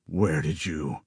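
low, soft and breathy; stunned breathy murmur; breathy little voice. A narrator's reading. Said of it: "he said in a breathy little voice".

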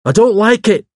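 high, loud, stubborn insistence; obstinate. A narrator's reading. Hawes repeats his objection to the vicar, obstinately. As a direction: dig in, pitched right up and forceful. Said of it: "repeated Hawes obstinately".

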